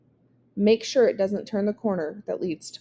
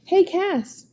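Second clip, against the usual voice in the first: "Hey" tossed up high across the field; calling out; 10 semitones higher, 3 dB louder than usual. A narrator's reading. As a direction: hail the batter from the mound. Pitcher calling to batter.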